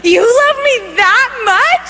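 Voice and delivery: high, drawn-out amazed question; giddy retold astonishment; joyful disbelief. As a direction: ask it incredulous and overjoyed, acting near laughter. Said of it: "so full of joy".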